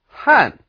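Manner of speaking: "han" falling sharply from high; fourth tone. Shown as a demonstration. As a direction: drop "han" hard from a high start. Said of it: "fourth tone".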